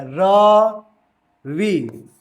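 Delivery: रवि with the र held very long; drawn-out Ravi. Shown as a demonstration. Teacher demonstrating a drawn-out रवि. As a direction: stretch रवि out slowly like calling. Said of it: "पूरा एकदम खींच के बोलेगा".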